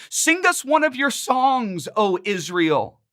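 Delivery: high, slow taunting call; captors crying out. Retold or imitated. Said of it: "they are crying out to us".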